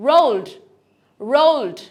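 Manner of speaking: রোলড loud with a firm D; strong final D. Shown as a demonstration. Teacher models past tense, রোলড with strong D. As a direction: land hard on the D of "রোলড". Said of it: "strong একটা ডি উচ্চারণ".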